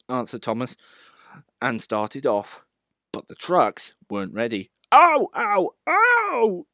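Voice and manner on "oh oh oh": high, loud squealing cries; mock screaming. A narrator's reading. Trucks jolted by their couplings, hammed-up screams. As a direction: yelp as the couplings jerk. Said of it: "they screamed".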